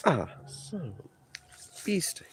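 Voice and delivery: slow frustrated whisper; whispered curse. Real, not acted. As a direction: hiss the curse under breath, slowly.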